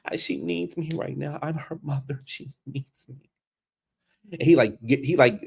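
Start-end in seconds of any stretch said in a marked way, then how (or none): 0.0-3.3 pleading mother. Retold or imitated. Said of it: "Kendra's still sitting there like".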